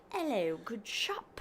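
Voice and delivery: slow, mock posh British "Hello, good shop"; posh British accent. Shown as a demonstration. Wife contrasting posh with Cockney British, in slow mock posh. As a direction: put on a plummy upper-class English greeting, slowly. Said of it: "But posh, it's like".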